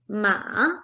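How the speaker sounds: "ma" falling, then rising; third tone, dipping. Shown as a demonstration. Teacher shows student the third tone, falling-rising "ma". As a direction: dip the pitch on "ma", then lift it. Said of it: "speak in all the four tones".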